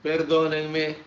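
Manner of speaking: ordinary talk, nothing marked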